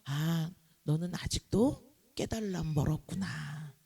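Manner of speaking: lightly voiced God, low and soft; a quiet, understated divine remark; God's disappointed verdict. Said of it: "하나님이 또 뭐라 그러세요".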